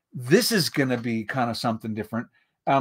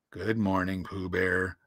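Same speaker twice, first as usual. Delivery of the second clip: low, soft downcast donkey; gloomy Eeyore; 7 semitones lower, 8 dB quieter than usual. A narrator's reading. Donkey answering Pooh Bear's greeting, gloomy. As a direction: return the greeting in a glum, low voice. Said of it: "said Eeyore gloomily".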